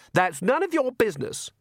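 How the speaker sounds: high-pitched indignant retort; furious. Acted. Daughter turns on her brother, indignant and furious. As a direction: snap back in a high voice. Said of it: "turned on him furiously".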